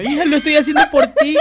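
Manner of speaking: high, loud, laughing mom voice; mock mother. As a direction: play a doting mother through laughter.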